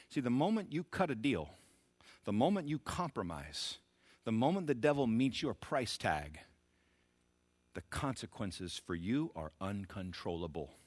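ordinary delivery

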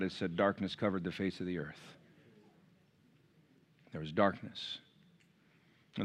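low, soft, grave preaching voice; hushed solemn preaching. Real, not acted. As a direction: bring the sermon down low and soft. Preacher drops to solemn explanation.

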